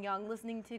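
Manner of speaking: ordinary talk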